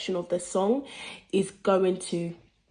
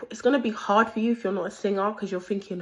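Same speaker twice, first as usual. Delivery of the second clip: normal talking voice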